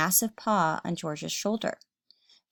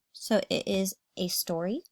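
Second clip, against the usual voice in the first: usual speaking voice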